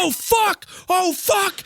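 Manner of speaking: high-pitched mock panic yelp; imitating frantic friend. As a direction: play a fisherman losing it over a catch. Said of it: "he's going nuts".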